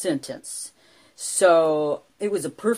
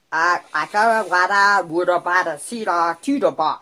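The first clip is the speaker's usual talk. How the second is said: gibberish; 6 dB louder than usual. Retold or imitated. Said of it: "I was just talking gibberish".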